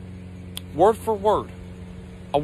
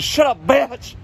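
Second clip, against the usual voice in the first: higher, louder comic comeback; mock outburst, pitched up; mock angry retort; 6 semitones higher, 5 dB louder, slower than usual. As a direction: cut her off loudly, imitating a rude uncle. Uncle snaps back at old woman.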